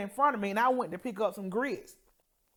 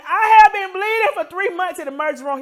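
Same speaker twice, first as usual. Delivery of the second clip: higher, louder protest at the doctor; patient insisting to a disbelieving doctor, raised voice; emphatic insistence; 9 semitones higher, 9 dB louder than usual. Retold or imitated. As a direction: insist hard that it's true.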